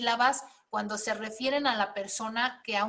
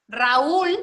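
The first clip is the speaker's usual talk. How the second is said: "Raúl" loud, stressed on the ú; stress on second vowel; 2 semitones higher, 7 dB louder than usual. Shown as a demonstration. Teacher showing a stressed second vowel, "Raúl" with a loud ú. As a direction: lean hard on the ú in "Raúl". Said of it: "la segunda va acentuada".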